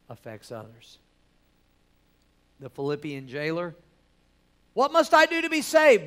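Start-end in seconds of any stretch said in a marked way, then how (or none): 4.7-6.1 high, loud pleading cry; desperate cry. Acted. Jailer begging Paul for salvation, loud desperate cry.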